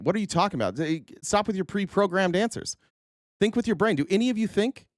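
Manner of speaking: rising, exasperated yell at the pundit. Real, not acted. Ranting at the TV pundit, exasperated rising yell.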